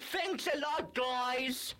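high-pitched cheerful farewell call. Acted. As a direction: call your thanks back to friends as you leave. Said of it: "he called out to the restricted toys".

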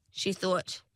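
ordinary delivery

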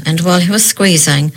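plain reading voice

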